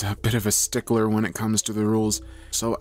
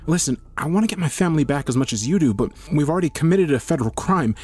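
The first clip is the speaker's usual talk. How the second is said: urgent reasoning; 6 semitones higher, 4 dB louder than usual. Acted.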